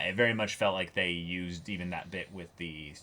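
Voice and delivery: ordinary talk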